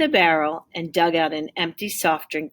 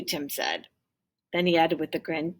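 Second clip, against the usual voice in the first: ordinary delivery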